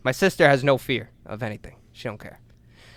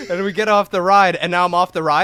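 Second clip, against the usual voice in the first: normal talking voice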